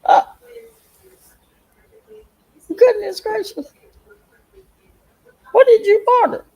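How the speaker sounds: high, loud gasp of disbelief; astonished exclaim. Real, not acted. Seeing a viewer's huge delivery box, high loud amazement. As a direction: react to the giant box.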